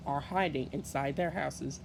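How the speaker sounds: ordinary delivery, nothing marked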